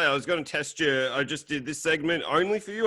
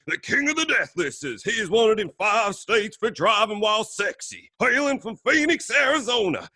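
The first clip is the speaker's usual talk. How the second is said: high-pitched Southern showman introduction; big Southern drawl, building to a loud drawn-out finish; Southern accent; 8 semitones higher, 5 dB louder than usual. Acted. Host introducing a special podcast guest, hammed-up Southern accent. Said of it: "Your Southern accent's amazing".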